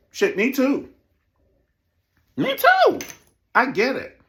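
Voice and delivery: loud, high exclamations; emphatic agreement. Real, not acted. Host admitting the same fear of success, loud emphatic agreement. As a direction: yell the agreement, pitch leaping up.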